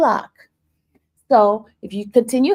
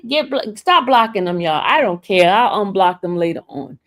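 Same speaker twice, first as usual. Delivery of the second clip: ordinary talk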